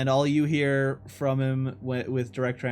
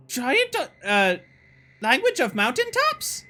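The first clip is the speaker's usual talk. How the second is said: very high, louder goblin squeak; a puzzled goblin asking, pitched way up; 12 semitones higher, 5 dB louder than usual. Acted. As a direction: goblin question. GM voices goblin.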